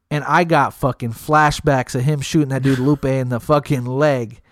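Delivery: usual speaking voice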